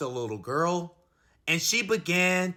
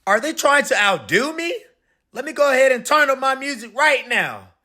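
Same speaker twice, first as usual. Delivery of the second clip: fast, high annoyed grumble; 7 semitones higher, 5 dB louder than usual. Acted. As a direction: huff about the honking. Ice cream man annoyed.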